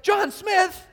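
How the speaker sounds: high, loud startled shout of your own name; hammed-up amazed cry; shocked disbelief. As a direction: yelp it in total disbelief. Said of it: "and you're like".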